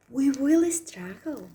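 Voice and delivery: ordinary talk, nothing marked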